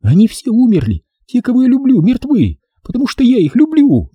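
high-pitched distraught woman; woman beside herself with grief; hysterical. Acted. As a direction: lament in frantic, high female voice. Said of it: "на истерической ноте".

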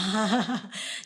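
a quick amused laugh; short laugh. Real, not acted. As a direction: chuckle at the story. Cười giữa câu chuyện. (tiếng cười ngắn)

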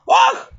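put-on high, sharp old-woman groan; an old woman hurting after a fall; a loud pained moan. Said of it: "простонала бабка".

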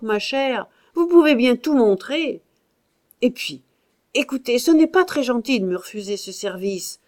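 loud, high furious tirade; angry outburst. A narrator's reading. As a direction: let the temper fly. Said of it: "il s'emporta tout à fait". (emportement furieux, à pleine voix)

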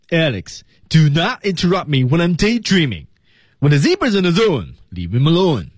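high-pitched, aggrieved cartoon demo; resentful. Shown as a demonstration. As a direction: scold Alex for breaking into your daydream. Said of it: "第二句仍然是有怨气". (带着怨气的动画配音腔)

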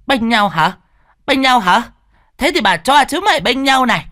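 high, loud mocking rage; savage taunt. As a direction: jeer as she strikes. Beating both, mocking.

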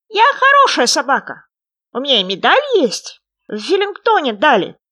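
high, loud little frog voice; small creature claiming to be a dog. A narrator's reading. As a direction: pipe it up proudly as a tiny frog.

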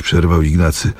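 normal talking voice

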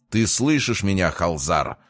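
usual speaking voice

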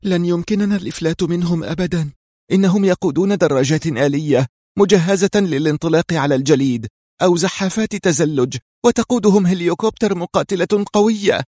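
winded, alarmed exclamation; breathless cry. A narrator's reading. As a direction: call out despairingly while running, pitch high. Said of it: "وبأنفاس لاهثة هتفت ريهام".

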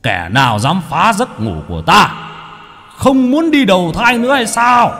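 a deity's thundering challenge; booming thunder voice. Acted. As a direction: boom it out like thunder. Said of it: "cất giọng ồm ồm như sấm".

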